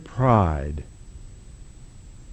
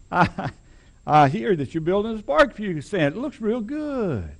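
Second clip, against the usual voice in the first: ingratiating boss man; 6 semitones higher than usual. Retold or imitated. Preacher voicing the big boss sweet-talking the newcomer. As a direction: sweet-talk him like a small-town boss who owns the place. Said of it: "just as ingratiating as he can be".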